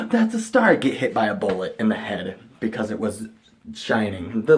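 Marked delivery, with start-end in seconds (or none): 0.1-0.8 high and loud, thrilled; a higher, louder mock cry; mock delight. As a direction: announce the star triumphantly. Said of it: "I'm like".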